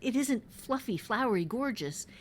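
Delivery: normal talking voice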